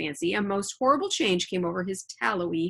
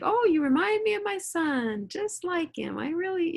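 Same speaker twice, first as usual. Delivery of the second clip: sweet-talking imitation; 8 semitones higher than usual. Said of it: "he's like".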